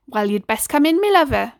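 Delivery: kindly West Country mum voice; warm invitation. Acted. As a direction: welcome him in tenderly. Neighbour invites child inside.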